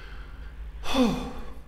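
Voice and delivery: soft, breath-only tired sigh; weary sigh. Shown as a demonstration. Teacher demonstrates being extremely tired, weary sigh. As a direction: let out an exhausted breath.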